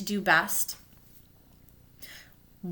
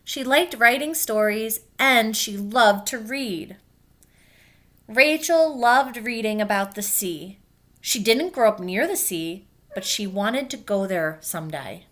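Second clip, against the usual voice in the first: gentle read-aloud storytelling; clear, even picture-book narration; reading to children. A narrator's reading. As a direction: read it like story time for kids. Teacher reads picture book aloud.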